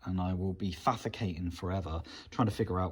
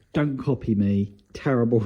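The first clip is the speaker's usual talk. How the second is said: louder, mock-stern warning; 11 dB louder than usual. Real, not acted. Warning viewers off his bodge fix, louder mock-stern. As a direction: call out to the viewers not to copy.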